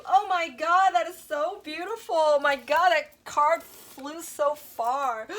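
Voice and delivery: high, loud gush of wonder at the card; thrilled amazement. Real, not acted. Reader amazed at a card that flew far: loud excited awe.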